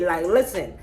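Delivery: ordinary talk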